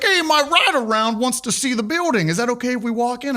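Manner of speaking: a hint of a chatty officer asking permission; cop's request.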